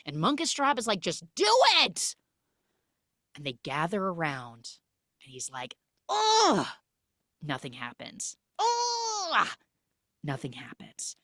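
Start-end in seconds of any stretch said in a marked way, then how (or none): 1.0-2.2 very high, loud order; hammed-up impatient outburst; mock exasperated yell. Cat leader pushes the doubting magician, exasperated mock yell. 6.1-6.7 high, loud effortful squeal; mock magical straining noise; straining grunt. Magic cat tries his first spell, high straining grunt. 8.6-9.5 high-pitched drawn-out effort noise; hammed-up squeal of exertion; straining grunt. Magic cat tries and nothing happens, high straining squeal.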